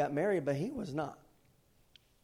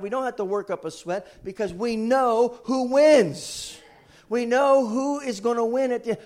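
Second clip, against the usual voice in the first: loud, high, rousing preacher delivery; full-force preaching; 7 semitones higher, 9 dB louder than usual. Real, not acted. Preacher assuring the church we know who wins, full-force preaching. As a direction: build loudly to each key word, drawing it out.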